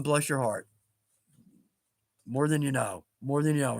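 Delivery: low, quiet voice choked with feeling; getting emotional. Real, not acted. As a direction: hold back the tears as you thank him. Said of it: "before I start getting emotional here".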